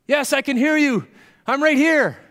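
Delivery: high, loud shout upward; calling out. Acted. Jack answers the voice from the cliff, calling out. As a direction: call up eagerly to a distant rescuer.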